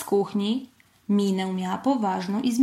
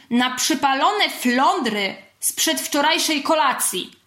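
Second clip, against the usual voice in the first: higher-pitched mock-angry exclamation; roared curse; 6 semitones higher than usual. A narrator's reading. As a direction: bellow a comic oath. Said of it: "ryknąłem". (gniewnie ryknięte przekleństwo)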